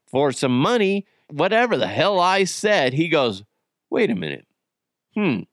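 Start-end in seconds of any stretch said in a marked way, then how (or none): 3.9-5.4 pondering son. Retold or imitated. Voices son's dawning suspicion.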